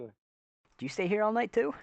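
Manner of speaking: ordinary delivery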